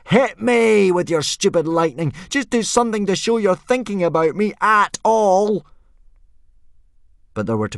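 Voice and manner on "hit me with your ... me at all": put-on boy screaming up at Thor. A narrator's reading. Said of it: "screamed Hiccup".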